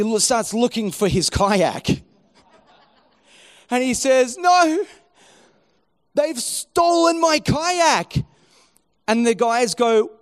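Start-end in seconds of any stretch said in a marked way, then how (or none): 4.4-8.2 high, loud cry of alarm, stretched out; mock-distraught, drawn-out outcry; rising panic. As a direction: imitate a friend getting worked up. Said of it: "starts to get a bit anxious. It starts to rise up".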